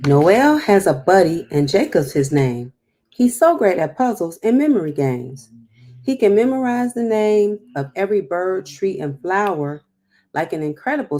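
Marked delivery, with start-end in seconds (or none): 0.1-9.8 louder, lively storybook reading; reading to children. Acted. Teacher reading rhymes to children, lively and clear. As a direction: give each rhyme its bounce for the kids. Reading picture book aloud.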